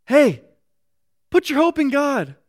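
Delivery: a sudden loud, high call to himself; self-reminder. Retold or imitated. Psalmist rousing his own soul, loud and high. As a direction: shake your own soul awake. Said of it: "he reminds himself".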